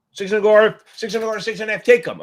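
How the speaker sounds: a hammed-up pit trader barking prices; mock trader call. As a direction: shout the bids like a floor trader. Calling out bids like a trader.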